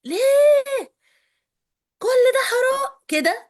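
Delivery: put-on high, full-throated yell at the kids; reenacted scream. Mother reliving her kitchen outburst, screaming. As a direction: scream it out in exasperation. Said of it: "واختم كلامي بزعيق".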